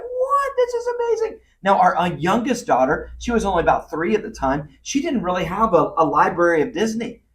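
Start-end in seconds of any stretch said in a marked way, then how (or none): 0.0-1.4 put-on super excited little girl. Dad retelling his oldest daughter's Disney surprise, super excited. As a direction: imitate an excited child in a high little voice. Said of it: "she was super excited".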